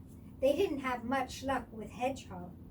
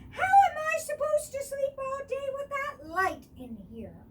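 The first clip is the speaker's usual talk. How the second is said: high, loud complaining badger; annoyed badger; 13 semitones higher, 9 dB louder than usual. A narrator's reading. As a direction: whine it like a woken badger.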